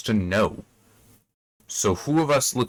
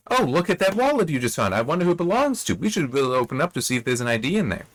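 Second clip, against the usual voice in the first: higher-pitched, hammed-up innocent discovery; con woman's feigned surprise; 5 semitones higher, faster than usual. Con artist baits her mark over a dropped wallet, feigned surprise. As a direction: play an obvious con, all fake wonder. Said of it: "the con is this woman pretends".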